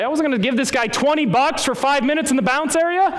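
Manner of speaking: high, strained indignant outburst; indignant protest. Retold or imitated. Pastor justifying not paying twenty bucks to bounce, indignant. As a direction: protest as if the price were outrageous.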